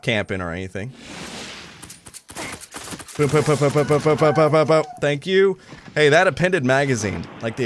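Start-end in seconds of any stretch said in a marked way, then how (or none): 3.1-4.8 mouth gunfire. Real, not acted.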